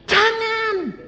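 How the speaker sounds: high, stretched refusal, softened; mock protest. Parent objecting to child becoming God's servant, high mock protest. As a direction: pitch it up in a drawn-out parental objection.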